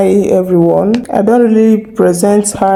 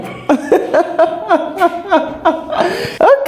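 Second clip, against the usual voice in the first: long, high, soft laughing fit; amused laughter; 9 semitones higher, 4 dB quieter than usual. Real, not acted. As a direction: laugh helplessly at the botched result. Amused at the AI's Pidgin.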